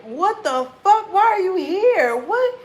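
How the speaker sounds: high, loud, slow what-the-fuck; stunned and outraged at the window; high incredulous shout; shocked disbelief. Pretend. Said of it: "And you like".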